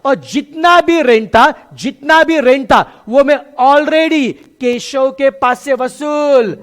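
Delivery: loud slow teacher emphasis; emphatic teaching. Real, not acted. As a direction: drive the point home to the class. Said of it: "teacher stressing point".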